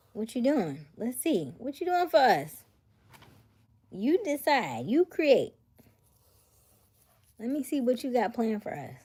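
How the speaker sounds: loud, high teasing demands; playful pressing. Real, not acted. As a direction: push him playfully for plans. Pressing partner about anniversary.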